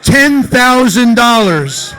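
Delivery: loud, slow, laughing shout of disbelief; excited laughing shout. Real, not acted. Astonished at ten thousand bid.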